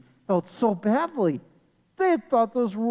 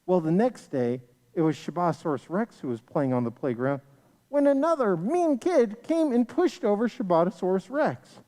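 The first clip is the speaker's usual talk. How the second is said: ordinary delivery, nothing marked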